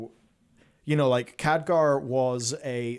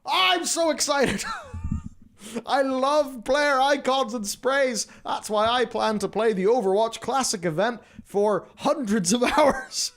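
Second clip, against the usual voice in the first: mock excitement; 10 semitones higher than usual. Sarcastic glee about sprays.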